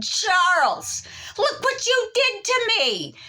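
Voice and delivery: very high, indignant cry; accusing wail. Retold or imitated. Wife bursts in accusing her husband Charles.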